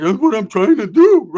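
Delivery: high, loud and slow protest; exasperated mock reply. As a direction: whine back at the mockers. Beaten rider answering mockers.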